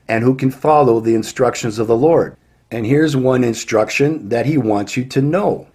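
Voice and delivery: usual speaking voice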